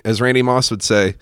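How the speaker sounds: ordinary talk, nothing marked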